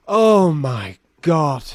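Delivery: slow, drawn-out disbelief; exasperated groan. Acted. Torturer after punching a hidden hardback book, exasperated groan. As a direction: stretch out each word in dismay.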